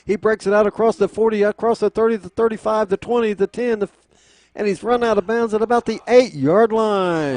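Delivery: fast, high, loud run call; excited play-by-play. Real, not acted. Big run call, rising excitement.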